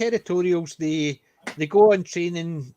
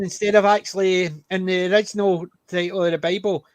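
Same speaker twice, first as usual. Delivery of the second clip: ordinary talk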